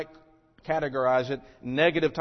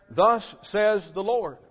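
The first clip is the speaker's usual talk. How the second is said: confident false prophet; 4 semitones higher, 3 dB louder, slower than usual. Retold or imitated. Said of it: "they confidently confirm and declare".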